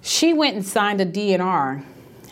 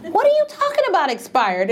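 indignant question; 8 semitones higher than usual. Retold or imitated. Retold argument with the nurse.

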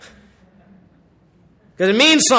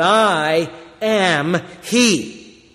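solemn slow quote; 3 semitones lower, much slower than usual. Acted. Quoting Jesus' declaration.

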